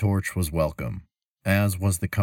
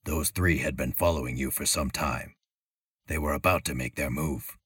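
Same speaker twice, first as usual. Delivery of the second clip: lower, softer creature voice; low male voice; 2 semitones lower, 4 dB quieter than usual. A narrator's reading. Archer telling the travelers about the danger, in a low quiet voice. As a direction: speak low and steady from the dark. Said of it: "the low voice of the male".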